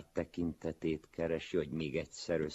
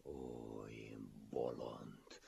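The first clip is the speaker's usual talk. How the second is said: low, slow, hushed lament; anguished self-reproach; 6 semitones lower, 12 dB quieter, much slower than usual. A narrator's reading. Wretch beats his head in remorse, quietly anguished. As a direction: groan it softly in despair. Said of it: "kiáltotta nyomorult fejét öklével verve".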